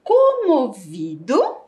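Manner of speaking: comovido said slowly with a rising end; slow and clear. Shown as a demonstration. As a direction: stretch out comovido for the class. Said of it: "olha a outra palavra que inicia com a letra C".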